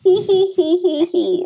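high mock "hee hee" giggle; teasing giggle impression. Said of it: "That's my impression of you".

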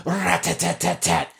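high mouth machine gun sound effect; machine gun noise. Pretend. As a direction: rattle off a toy gun burst. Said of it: "Sound effects".

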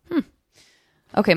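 ordinary talk, nothing marked

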